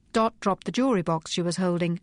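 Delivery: ordinary delivery, nothing marked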